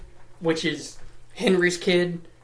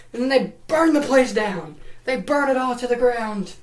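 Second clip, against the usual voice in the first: higher, worked-up storytelling; dramatic climax; 6 semitones higher than usual. Real, not acted. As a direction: build the destruction up high and dramatic.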